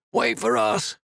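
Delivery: high cry after a departing boat. A narrator's reading. Peter crying out to the boat pulling away, high cry. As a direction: call out desperately. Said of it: "Peter cried out".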